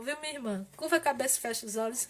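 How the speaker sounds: usual speaking voice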